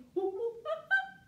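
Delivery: high hooting monkey laugh; a silly high monkey giggle. Pretend. Teacher to kids, playing the monkey, high giggle.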